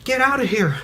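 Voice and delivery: high, desperate pleading; terrified warning. Retold or imitated. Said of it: "she held it out and she was like".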